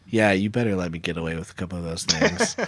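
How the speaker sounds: low, soft warning; quietly blackmail them in a theater; hushed conspiratorial threat; mock veiled threat. Mock threat to bishop, kept low.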